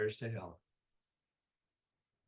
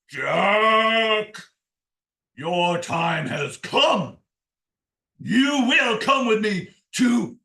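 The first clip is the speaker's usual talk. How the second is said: put-on loud, slow, booming Old Scratch; big devil voice; 5 semitones higher, 9 dB louder, much slower than usual. A narrator's reading. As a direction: draw out each word as a menacing devil. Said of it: "Scratch has a big, deep voice".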